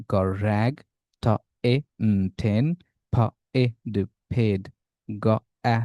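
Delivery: letter sounds one by one, then whole, as in "খ, আ, প, খ্যাপ"; sounding out words. Shown as a demonstration. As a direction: break up "ব, আ, গ, ব্যাগ" like a phonics drill. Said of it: "নিজের শব্দগুলো জোরে জোরে পড়ে আপনার ব্লেন্ডিং". ("হ, এ, ন, হেন" ধ্বনি ভেঙে, তারপর জুড়ে পড়া)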